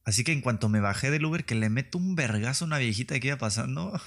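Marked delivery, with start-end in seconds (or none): none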